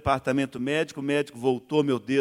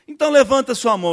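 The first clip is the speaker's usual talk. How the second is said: high, loud call; preacher telling him to raise his hand, loud call; preacher's command; 8 semitones higher, 13 dB louder, faster than usual. Retold or imitated. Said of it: "falação dele".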